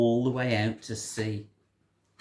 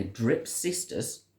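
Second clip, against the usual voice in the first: plain reading voice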